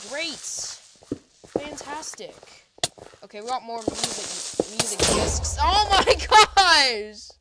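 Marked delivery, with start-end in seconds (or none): 5.6-7.3 high, loud, drawn-out exclamation; stunned outcry, stretched out; shocked shout. Real, not acted. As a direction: blurt it out loud, half laughing. Sudden shock in game.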